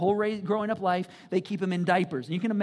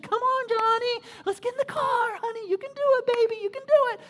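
mock coddling mom; 13 semitones higher, 4 dB louder than usual. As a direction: coo and coax like he is a toddler. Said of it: "she's like".

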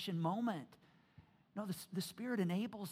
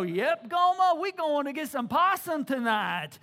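loud, high Kentucky hillbilly drawl; 5 semitones higher, 10 dB louder, slower than usual. Retold or imitated. Preacher teasing Kentucky folk, loud hillbilly accent. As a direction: ham up a backwoods Kentucky accent. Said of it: "or, or Kentuckian".

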